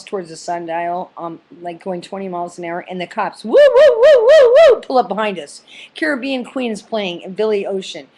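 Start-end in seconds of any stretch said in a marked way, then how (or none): 3.4-4.8 high, loud wailing police siren; siren imitation. Cops pulling up behind the car, imitated siren whoops.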